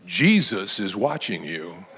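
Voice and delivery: low, ominous voice from the dark; low-pitched eerie warning. Acted. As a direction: warn a burglar from the shadows, very low. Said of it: "he hears a voice in the dark saying".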